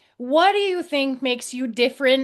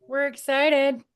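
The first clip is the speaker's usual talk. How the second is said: ordinary talk, nothing marked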